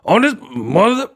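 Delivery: high, drawn-out exasperated movie outburst; mock a fed-up action hero, pitch way up; Samuel Jackson. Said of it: "Samuel Jackson, the real one, he's like".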